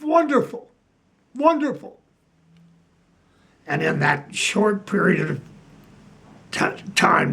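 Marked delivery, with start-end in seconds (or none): 0.0-2.0 delighted. Retold or imitated. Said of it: "And Bob Sidney goes".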